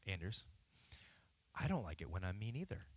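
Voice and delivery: low, soft and gentle; gentle fatherly. Retold or imitated. Dad retelling kneeling to talk to his son, gentle. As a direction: kneel down and soften for your son. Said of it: "I get down on one knee".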